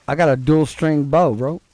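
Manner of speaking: usual speaking voice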